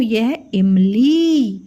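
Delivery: इमली said slowly, each syllable clear; slow word demo. Shown as a demonstration. Teacher gives children a word starting with इ. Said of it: "शब्द बताती हूँ".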